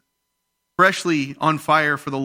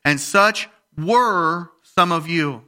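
slow, leaning hard on "were"; stress on were; 4 semitones higher, 4 dB louder, much slower than usual. Shown as a demonstration. Preacher stressing "were" to the congregation, slow and weighty. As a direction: land on "were", drawn out and weighted. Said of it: "Were is the key word".

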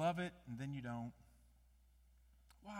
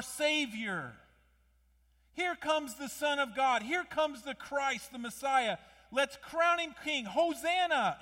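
higher, louder, slow cry of a cheering crowd, building to Hosanna; crowd shout; 7 semitones higher, 9 dB louder than usual. Retold or imitated. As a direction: call it out like the celebrating crowd. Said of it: "and they would shout".

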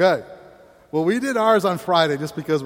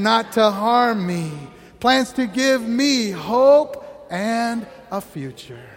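slow, louder recitation with "me"; personal declaration; 3 semitones higher, 4 dB louder, slower than usual. Real, not acted. Pastor leading the personalised reading, slow and louder. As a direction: claim the verse for yourself, slowly. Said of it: "replace you with I".

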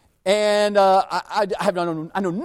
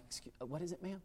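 whispered, much softer; whispering back; 19 dB quieter, faster than usual. Retold or imitated. Teen cashier whispering back to an old lady. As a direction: lean in and whisper the question. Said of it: "she's whispering her".

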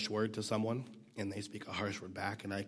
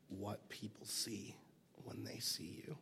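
whispered; 9 dB quieter, slower than usual. Real, not acted. Sermon point said hushed.

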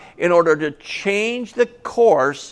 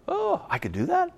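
surprised delight; 12 semitones higher, 8 dB quieter than usual. Acted. Nehemiah surprised by king's offer.

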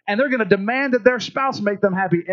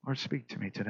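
soft, hushed prayer voice, the repeat fading lower; quiet prayer; 7 semitones lower, 9 dB quieter than usual. Shown as a demonstration. Pastor shows the congregation a quiet prayer, softly. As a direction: pray it softly as a model for the congregation. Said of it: "Just say".

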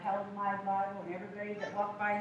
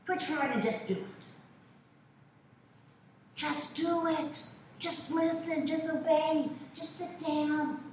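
higher, louder stern father; stern dad; 7 semitones higher, 3 dB louder than usual. Retold or imitated. Father lecturing his daughter, higher and louder. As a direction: lay down the law, word by word. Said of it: "And he'd go".

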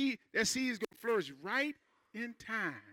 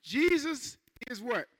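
slow call for response; 3 semitones higher, slower than usual. Shown as a demonstration. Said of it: "Everybody say".

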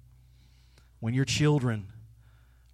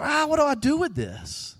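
much higher, flustered cry; flustered panic; 11 semitones higher than usual. Retold or imitated. Someone overwhelmed by their own feelings, high and flustered. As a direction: throw up your hands, overwhelmed. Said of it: "it's like".

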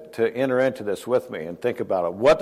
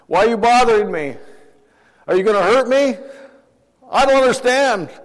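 scared stranger; 8 semitones higher, 3 dB louder than usual. Pretend.

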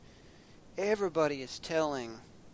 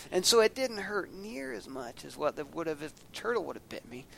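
ordinary talk, nothing marked

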